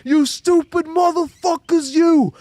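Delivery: high, loud, slow mock fan yell; angry New York fan. Streamer repeating the fan's insult to the team. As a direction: belt it like the furious Knicks fan. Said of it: "the most New York thing".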